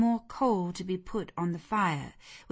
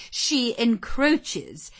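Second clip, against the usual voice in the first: high, slow, louder indignation; disapproving; 7 semitones higher, 6 dB louder, much slower than usual. Acted. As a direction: draw it out in affront. Lady Ingram disapproves of the visitor.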